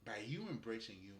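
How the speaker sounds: ordinary delivery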